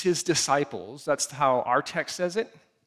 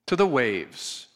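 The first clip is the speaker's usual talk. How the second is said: usual speaking voice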